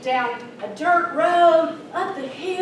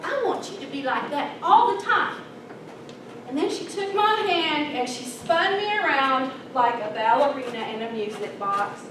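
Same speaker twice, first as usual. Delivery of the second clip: ordinary talk, nothing marked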